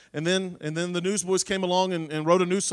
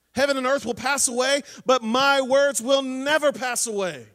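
louder, driving sermon voice; preaching at full force; 2 semitones higher, 4 dB louder than usual. Acted. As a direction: preach it out to the congregation. Preacher insists Bible will last.

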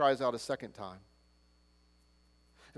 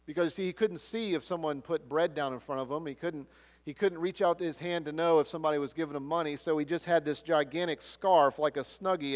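ordinary talk

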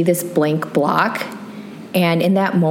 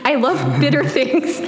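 high-pitched, giggling confession; laughing; 10 semitones higher than usual. Real, not acted. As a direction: crack up while admitting it, voice pitched high. Cracking up at own confession.

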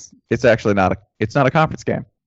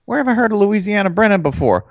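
higher, wondering and confused; puzzled fan voice; 10 semitones higher than usual. Retold or imitated. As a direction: muse aloud, half hungry. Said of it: "you're like".

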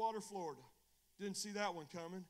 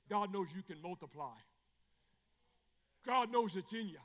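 raised, emphatic pulpit voice; forceful preaching; 5 semitones higher, 6 dB louder than usual. Real, not acted. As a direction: drive each point home to the congregation.

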